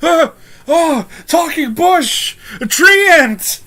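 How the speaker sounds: very high, loud, drawn-out terrified cries; freaking out. Acted. Guard sees a talking bush, freaking out. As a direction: cry out in hammed-up terror. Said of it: "he starts to freak out".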